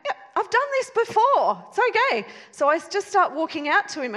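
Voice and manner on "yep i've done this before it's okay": high, laughing, cocky reassurance; breezy overconfidence. Retold or imitated. Wife stepping onto the beams, breezily overconfident. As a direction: brush it off like a pro. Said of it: "and I'm like".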